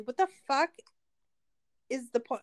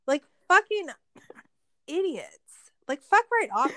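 louder, higher fucking, then falling on idiots; angry outburst; 4 semitones higher, 6 dB louder than usual. Real, not acted. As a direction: spit out the insult. Ranting about the cops.

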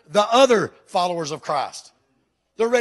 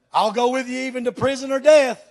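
the vow pushed louder and fuller; 5 semitones higher, 7 dB louder than usual. Acted. Follower vowing louder to his pastor under pressure. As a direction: swear it harder as the heat rises. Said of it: "Heat gets turned up".

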